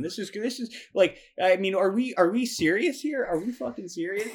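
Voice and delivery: incredulous rant. Real, not acted. Host ranting in disbelief.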